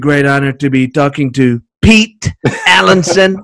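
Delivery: Trump impression with a grimace; Trump voice demo. Shown as a demonstration. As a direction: flatter the host like Trump. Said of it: "just doing the face really helps the voice".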